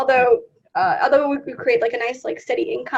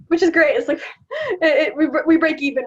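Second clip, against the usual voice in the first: laughing; 3 semitones higher, 6 dB louder than usual. Real, not acted. Laughing about breaking even.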